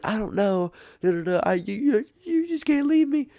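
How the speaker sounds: high, whining mock-girlfriend plea; whiny girlfriend. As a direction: beg in a high squeaky imitation. Girlfriend begs him to stay.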